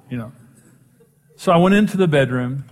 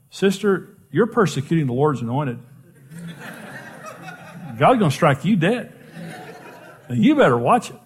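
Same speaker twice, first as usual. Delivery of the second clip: pompous preacher scolding, pitch climbing higher; hammed-up holier-than-thou warning to his wife; mock self-righteous rebuke; 3 semitones higher than usual.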